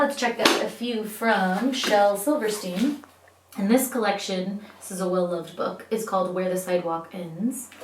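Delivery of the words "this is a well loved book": plain reading voice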